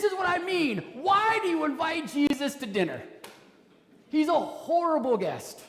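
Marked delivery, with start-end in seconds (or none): none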